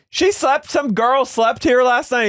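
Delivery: very high imitation of her protest; hammed-up high-pitched accusation; mock outrage. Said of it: "And she goes".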